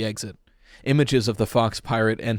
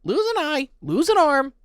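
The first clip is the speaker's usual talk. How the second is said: very high squawking parrot voice, loud; parrot shriek; 5 dB louder than usual. A narrator's reading. Parrot taunting the frightened boy, high shrieking squawk. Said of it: "A parrot shrieked".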